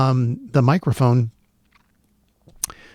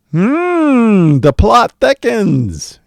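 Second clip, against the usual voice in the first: slow, loud, higher hammed-up detective voice; mock mystery; 10 semitones higher, 6 dB louder, much slower than usual. Telling viewers the mic mystery deepens, hammed up. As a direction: ham it up like a noir sleuth.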